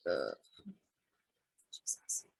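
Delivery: whispered under breath; exasperated whisper. Real, not acted. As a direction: breathe it out, fed up. Exasperated at co-host's laughing.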